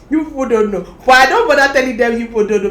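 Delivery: loud, fast emphatic aside. Real, not acted. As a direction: blurt it out loudly.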